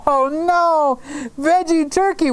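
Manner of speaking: high, hammed-up "oh no"; playful horrified squeal; mock dismay. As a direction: pretend to be shocked by the menu. Reacting to David's veggie turkey.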